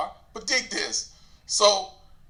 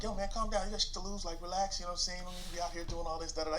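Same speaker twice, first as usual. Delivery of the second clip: calm soothing advice; 12 dB quieter, faster than usual. Retold or imitated. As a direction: talk him down gently. Aries calming him down.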